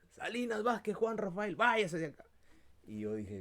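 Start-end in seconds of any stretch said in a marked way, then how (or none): 0.1-2.3 high, loud dismissal; booming professor. Retold or imitated. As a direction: send him off loudly. Profesor lo despide aprobado. (profesor en voz alta)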